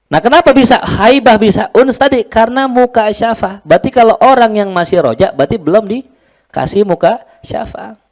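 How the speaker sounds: high, loud preacher's climb; preaching at full force. Acted. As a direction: lift the voice and drive the point home. (khutbah bersemangat dengan suara tinggi dan keras)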